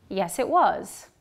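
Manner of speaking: slow, strong "was" at the end; stressed was. Shown as a demonstration. Teacher models the short answer with strong "was". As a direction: stress "was" with a full vowel, falling. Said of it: "listen to the sentences twice".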